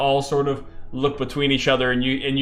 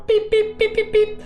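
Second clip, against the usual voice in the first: high electronic beeps; imitated alarm tone; machine beeping. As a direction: beep like a chamber alarm. Fluid chamber alarm.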